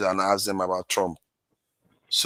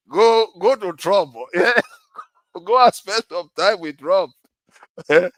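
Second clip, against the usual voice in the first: mock dismissal; 8 semitones higher, 5 dB louder than usual. Teasing guests to go to Trump.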